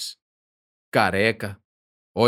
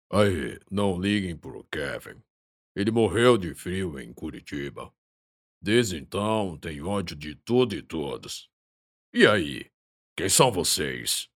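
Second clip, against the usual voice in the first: deep chesty voice; 3 semitones lower than usual. Acted. The Rock explains about Kevin.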